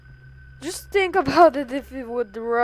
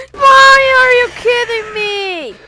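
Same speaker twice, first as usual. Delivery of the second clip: higher, loud panicked yell; furious shout; 12 semitones higher, 16 dB louder than usual. Real, not acted. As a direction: scream it in shock and anger. Killed by jumpscare.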